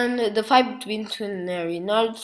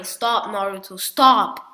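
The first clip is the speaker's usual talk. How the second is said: crying plea; 8 dB louder than usual. Acted. Hinata begging Naruto to stop, mock crying plea. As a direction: beg him through mock tears. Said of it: "And she starts crying".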